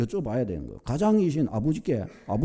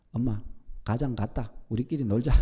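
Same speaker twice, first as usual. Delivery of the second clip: normal talking voice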